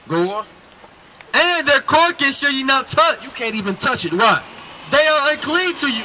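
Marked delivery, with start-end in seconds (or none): 1.3-3.2 slow, higher reading of the verse; scripture reading. Acted. Reads scripture aloud. 4.9-6.0 scripture reading. Acted. Reads scripture aloud.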